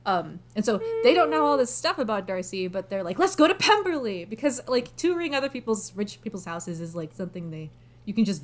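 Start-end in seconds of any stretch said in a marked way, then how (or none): 3.2-4.2 high, lifted mock-eager quote; eager suggestion. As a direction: imitate someone keenly proposing an outing. Retelling relatives' eager suggestion.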